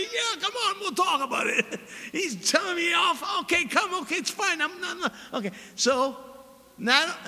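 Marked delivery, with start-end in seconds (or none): 0.0-5.1 high mock grumbling, half laughing; lightly voiced angry parishioner; imitating the angry man. Grumbling man walked arm in arm, mock protest. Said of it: "He's yelling at me".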